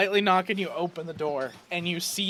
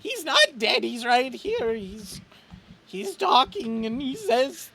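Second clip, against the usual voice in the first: high-pitched crying protest, building to a drawn-out wail; tearful denial; 12 semitones higher, slower than usual. Acted. Chet denies his friend Graham is dead, crying and wailing. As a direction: wail it through tears, refusing to accept the loss. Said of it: "wiping away".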